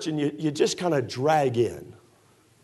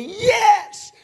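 high, loud cheer of joy; excited yes; 4 dB louder than usual. Retold or imitated. Fan cheering a basket at a ball game, excited shout. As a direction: shout it like the ball just dropped in. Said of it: "You get all excited. You say".

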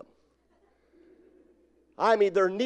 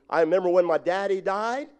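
normal talking voice

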